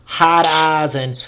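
slow, dropped-r Boston, "Harvard Yard" as "Hahvahd Yahd"; overdone Boston drawl; cartoonish Boston accent. Shown as a demonstration. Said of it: "they make it all, like".

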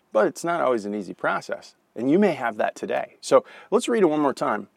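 usual speaking voice

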